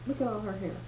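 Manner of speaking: ordinary delivery, nothing marked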